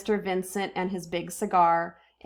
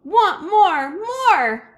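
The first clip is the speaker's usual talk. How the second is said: put-on high, loud wailing kid voice; a toddler bawling for more; crying toddler demand; 11 semitones higher, 9 dB louder, much slower than usual. A narrator's reading. Crying toddler Fudge demanding more from his father, in a high wail. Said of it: "Fudge started to cry".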